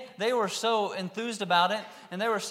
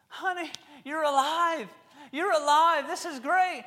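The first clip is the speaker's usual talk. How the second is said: high, slow joyful cry of a father; overjoyed father; 10 semitones higher, 7 dB louder than usual. Acted. Father greeting his raised daughter, high and overjoyed. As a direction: let the father's relief burst out high.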